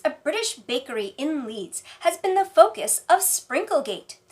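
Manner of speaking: ordinary delivery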